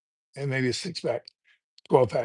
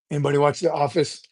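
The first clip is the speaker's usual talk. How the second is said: ordinary talk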